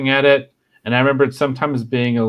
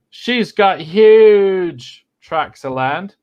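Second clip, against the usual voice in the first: slow, loud, higher British drawl; hammed-up quote; 7 semitones higher, 6 dB louder, much slower than usual. Shown as a demonstration. [name] relishes his favourite quote in a slow British accent. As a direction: savour it slowly like a smug lord. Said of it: "the one I like the most".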